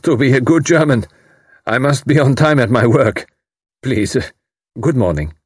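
wry. A narrator's reading. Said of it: "He laughed".

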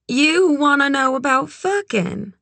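alert, high-pitched question; soft and alert. A narrator's reading. Red sits up in bed at Kit's question, alert. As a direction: perk up, pitched well above narration. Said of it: "in a voice that was soft and alert".

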